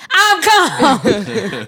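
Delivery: high, loud laughing protest; mock-insisting "I'm calm" while laughing. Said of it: "I am calm".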